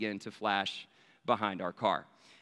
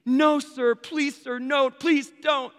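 high-pitched, drawn-out panicky begging; hammed-up frantic plea, voice way up; mock panicked pleading; 11 semitones higher, 5 dB louder, much slower than usual. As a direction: imitate a friend pleading in a rising panic. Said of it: "Peter's voice kinda start to elevate".

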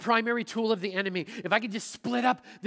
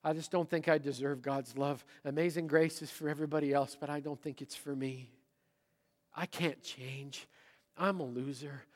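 self-pitying voice; 5 semitones lower, 6 dB quieter than usual. Retold or imitated. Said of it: "Have you ever found yourself saying".

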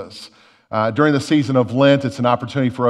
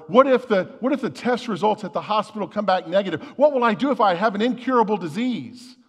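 higher-pitched mock fretting; acted worry; 7 semitones higher than usual. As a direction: voice an anxious what-if, raised in pitch. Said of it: "That's called worry".

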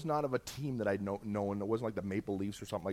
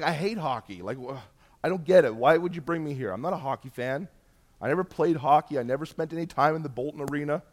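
louder, rising indignant outburst, higher in pitch; frustrated protest; 4 semitones higher, 6 dB louder than usual. Retold or imitated. Protesting to Jesus about the hockey arena, indignant and loud. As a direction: blurt out your frustration at being brought here.

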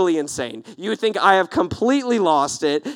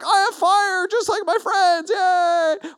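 put-on much higher, bright childish cheer; mock giddy joy; 11 semitones higher, slower than usual. Worshipper gloating over borrowed fire, mock giddy joy. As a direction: squeal it like a delighted kid.

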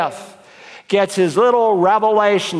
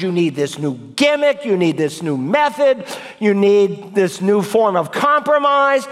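raised-pitch exhortation to Timothy, slowing on Just preach the word; earnest preaching; 4 semitones higher than usual. Acted. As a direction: urge the pastor, higher and insistent. Preaching at full force.

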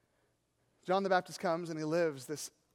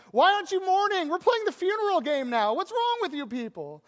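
high, loud, fast indignant yell; mock yell; 12 semitones higher, 9 dB louder than usual. Kid yelling at friends ignoring the funeral game, mock yell. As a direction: shout at friends who won't play along. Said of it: "You yell at him".

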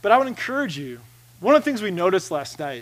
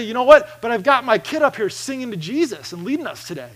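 emphatic reply; 6 semitones higher, 6 dB louder than usual. Retold or imitated. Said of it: "And I say".

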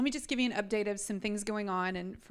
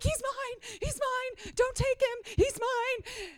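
high, near crying cries of he's mine; panicked pleading; much slower than usual. Retold or imitated. Panicked mother pleading with the police not to take her son. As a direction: beg them not to take him, panicked. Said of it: "I am panicking".

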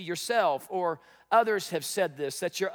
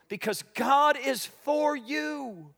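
slow, raised proclamation; preached refrain; 6 semitones higher, slower than usual. Acted. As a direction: lift the line and land it slowly. Sermon refrain.

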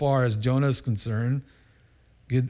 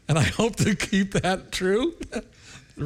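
laughing; 7 semitones higher, slower than usual. Real, not acted. Laughing while speaking.

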